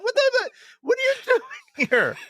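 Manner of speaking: very high, fast panicked yelp; startled awake by an intruder; freaked out. Retold or imitated. Cohost imagining an alien in his bedroom, panicked. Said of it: "I'd be like".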